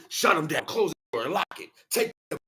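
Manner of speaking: ordinary talk, nothing marked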